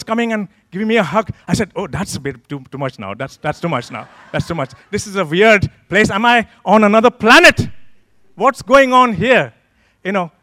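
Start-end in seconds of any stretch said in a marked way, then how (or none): 1.7-4.7 low, soft muttered objection; uneasy protest. Retold or imitated. Uncomfortable inner reaction to hugs. 4.9-9.5 building to loud, high-pitched disbelief; retold bewilderment. Bewildered reaction to a strange church.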